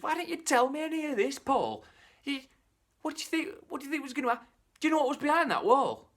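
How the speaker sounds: high, sharp wife's voice scolding her husband; a wife telling off her foolish husband, high and loud. Acted.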